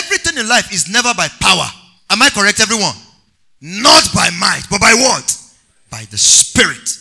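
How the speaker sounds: loud, emphatic scripture preaching; loud call-and-response sermon delivery; full-force preaching. Real, not acted. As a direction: punch out the scripture for the congregation.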